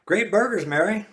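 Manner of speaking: bright loud praise; hearty thanks to the host; complimenting. Acted. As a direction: guest praising the wife's burgers, hearty. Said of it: "complimenting Richard's wife".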